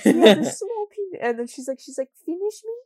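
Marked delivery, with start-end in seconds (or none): 0.0-1.2 put-on very high, loud accented voice through laughter; mock Spanish-accented woman, shrill and giggling; Estefania imitation. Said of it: "she, like, says it like that". 2.2-2.8 put-on very high accented coo; breathy high mock Spanish accent; Estefania imitation. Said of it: "she, like, says it like that".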